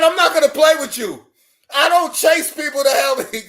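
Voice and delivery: loud, fast, firm telling-off; firm scolding. Retold or imitated. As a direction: chef refusing to chase him, firm and loud. Chef scolds him for dodging.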